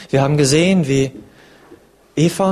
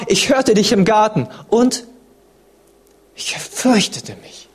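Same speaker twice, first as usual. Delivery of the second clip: ängstlich; 5 semitones higher, slower than usual. Acted. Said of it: "und er sprach".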